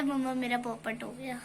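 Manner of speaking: low, very soft admission; retold sheepish confession. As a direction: let it sink down as you own up to mum. Child retells her dejected confession to mum. (झेंपते हुए, धीमी दबी आवाज़ में)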